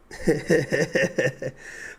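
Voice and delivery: bursting out laughing; loud laugh. Real, not acted. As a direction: laugh out at yourself. Laughs at own bad voice.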